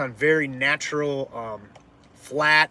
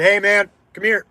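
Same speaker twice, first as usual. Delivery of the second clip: raised call across the crowd; calling him over; 5 semitones higher, 7 dB louder than usual. Retold or imitated. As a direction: call out firmly to get his attention. Calling out to a guy sneaking vodka.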